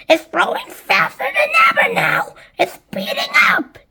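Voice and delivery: very high excited squawk; frantic high cry; shouted. A narrator's reading. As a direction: shout it in high-pitched amazement. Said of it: "Anne Spiker shouted".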